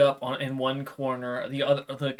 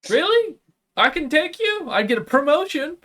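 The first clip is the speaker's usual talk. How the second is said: very high, eager squeaky imp; excited imp voice; 13 semitones higher, 5 dB louder than usual. Acted. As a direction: gush like a greedy little gremlin. Imp thrilled by offer.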